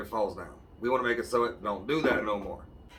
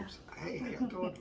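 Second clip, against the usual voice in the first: high, hushed whispered greeting; whispering; 9 semitones higher, 9 dB quieter than usual. Real, not acted. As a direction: whisper a shy hello.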